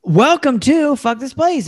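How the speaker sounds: slow, high announcer intro; podcast announcer. Acted. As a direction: announce the show with a flourish.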